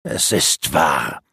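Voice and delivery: low, very slow cold assurance; a traitor coldly crushing her denial; merciless confirmation. A narrator's reading. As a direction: lay it down without mercy. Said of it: "versicherte der Abtrünnige unbarmherzig".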